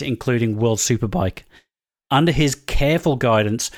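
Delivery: scripted reveal read. Acted. Reads scripted mystery-guest bio.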